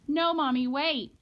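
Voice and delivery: put-on child crying out to halt Mommy; urgent protest. A narrator's reading. Child blocks mother, begging.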